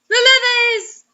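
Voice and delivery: high loud comic cry; mock alarm. Real, not acted. Co-host crying out in mock alarm about the levees.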